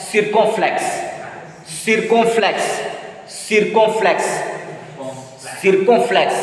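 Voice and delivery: "circumflex" said "seer-kon-flex"; "circumflex" with ee and nasal o; French pronunciation drill. Shown as a demonstration. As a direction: repeat "circumflex" the French way, pausing between. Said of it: "In French".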